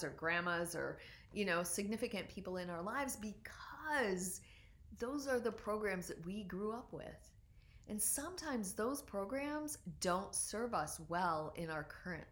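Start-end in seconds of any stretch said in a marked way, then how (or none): none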